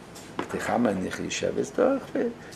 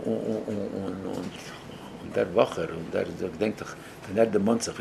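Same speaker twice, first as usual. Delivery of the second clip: ordinary talk, nothing marked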